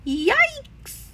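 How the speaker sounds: very high startled yelp; a shrill jolt of fear; frightened yelp. A narrator's reading. Papa wakes from nightmare.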